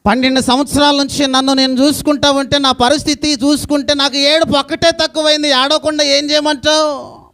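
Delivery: full-voiced lament from the pulpit; impassioned preaching. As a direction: preach her despair out to the crowd. Preacher voicing sick woman's despair.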